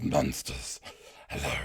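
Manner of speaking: deep, slow, grotesque monster growl. Shown as a demonstration. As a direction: rumble it out like a lurking monster. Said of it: "I do the voices of, of monsters".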